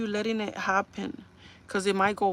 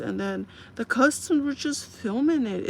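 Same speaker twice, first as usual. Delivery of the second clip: ordinary talk, nothing marked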